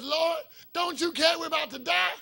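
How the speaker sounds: loud, high panicked plea; acted desperate cry to the Lord; crying for their lives. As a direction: shout it as a mock-terrified appeal. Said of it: "they're crying for their lives".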